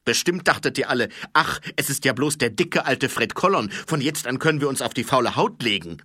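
loud, fast gloating taunt; captain Colon taunting lazy corporals; malicious gloating. Acted. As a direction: relish catching them slacking. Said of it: "mit einem boshaften Glitzern".

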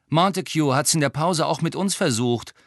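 lightly voiced cheeky twin.

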